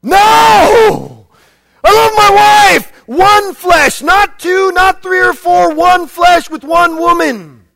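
loud, high, emphatic shout; shouted refusal. Acted. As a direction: bellow the refusal from the pulpit.

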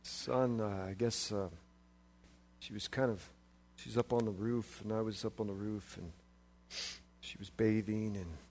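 very soft, low, hesitant mumble; King David fumbles answering son about meeting Mom; embarrassed mumble. Retold or imitated. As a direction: mumble it like a dad caught out.

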